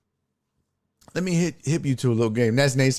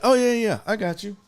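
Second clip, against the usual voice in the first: higher-pitched imitated server; obliging server; 7 semitones higher than usual. Server answering a fries request, readily obliging. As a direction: lightly mimic an agreeable server in a higher voice.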